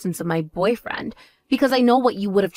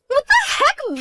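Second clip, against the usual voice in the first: high, offended outcry; indignant shriek; slower than usual. Acted. Insulted sister snapping back at her older sister, indignant. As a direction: fire it back, scandalised.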